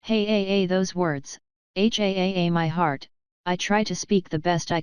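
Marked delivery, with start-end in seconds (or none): none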